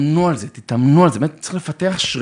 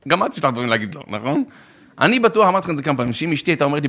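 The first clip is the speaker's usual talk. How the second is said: usual speaking voice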